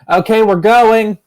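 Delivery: high, loud drawn-out call; shout back. Acted. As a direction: holler back to the group, stretched out. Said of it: "Thorn's gonna shout back".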